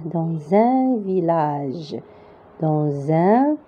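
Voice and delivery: "Dans un" linked with a z sound, drawn out slowly; liaison. Shown as a demonstration. Teacher showing the liaison in "Dans un", slow and linked. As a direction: join "Dans" to "un" as dan-zun. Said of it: "on va mettre la liaison".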